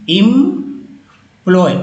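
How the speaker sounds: slow, split employee; teacher slowly sounding out a word; syllable by syllable. Shown as a demonstration. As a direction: hold इम, then add ploy. Teacher builds word from parts.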